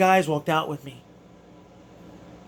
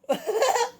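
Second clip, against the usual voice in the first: giddy laugh; 5 dB quieter than usual. Real, not acted. After reliving the win.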